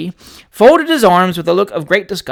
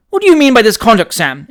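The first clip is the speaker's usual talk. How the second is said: louder and higher, affronted gentleman; indignant; 4 semitones higher, 6 dB louder than usual. A narrator's reading. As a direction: demand it with injured dignity. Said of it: "inquired Mister Winkle indignantly".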